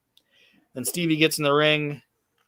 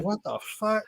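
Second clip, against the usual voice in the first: high baffled question, a bit softer; mock-bewildered, pitched way up; 8 semitones higher, 4 dB quieter than usual. Retelling a wrestling match, confused newcomer, high and baffled. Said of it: "He's like".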